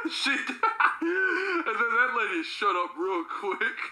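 words broken up by laughter; giggling while telling it; laughing. Real, not acted. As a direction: laugh through the line.

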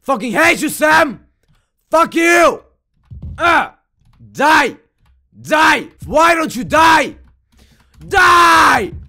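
high, loud gamer rage; exasperated furious shouts; angry shouting. Real, not acted. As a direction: yell it at the enemy, building to a long scream. Raging at game character.

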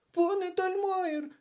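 put-on very high, loud child voice; children calling out. A narrator's reading. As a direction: call for the teacher in stretched, high cries. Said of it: "загукали вони".

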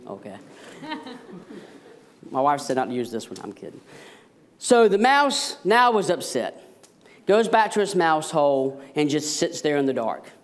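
ordinary talk, nothing marked

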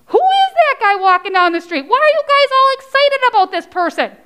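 high, increasingly quick questioning; puzzled bystander. Acted. Onlooker asking why everyone is excited, high and puzzled. Curious bystander asks in story.

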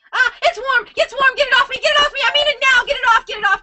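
high, panicked pleas to get it off; panicked shrieking at her son; real squeal of panic. Real, not acted. Blindfolded, handed a live fish.

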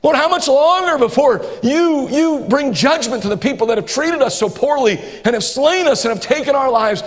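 high, insistent plea to the Lord; imitated complaint. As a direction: voice the martyrs' protest to heaven, pressing hard. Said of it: "They're complaining in heaven".

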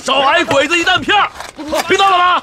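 high-pitched call to the diggers; rally the men like a drill leader; shouting slogans. Acted. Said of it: "口号喊得不错". (高声喊口号给大家鼓劲)

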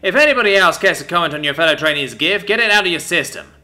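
loud, high challenge to the room; loud order. Acted. Sergeant challenging whole dorm, loud order. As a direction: dare them all, loud. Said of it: "he ordered".

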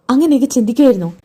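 angry insistent repeat of അങ്ങനെയൊക്കെ ചിന്തിക്കുമായിരുന്നോ. Acted. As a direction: press the question, demanding an answer.

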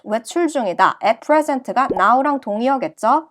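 ordinary talk, nothing marked

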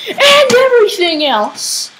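high, loud cry finishing the list; sudden outburst. Real, not acted. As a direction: burst out high on the last words. Bursts out in the sign-off.

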